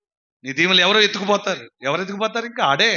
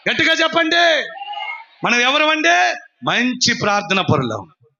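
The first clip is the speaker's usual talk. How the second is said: high, shouted pulpit proclamation; full-force preaching; 10 semitones higher, 4 dB louder than usual. Acted. Preacher promising blessing and calling for loud Amens, full-force preaching. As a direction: proclaim it high and rouse the crowd.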